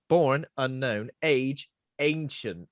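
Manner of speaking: slow, loud, ominous; ominous slow read. Pretend. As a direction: read it like a trailer. Reading the wiki dramatically.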